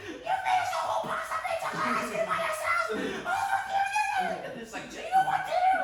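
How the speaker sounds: shrill high laughing mom rant; screaming mom. Retold or imitated. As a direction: imitate a furious mom yelling. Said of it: "just screaming".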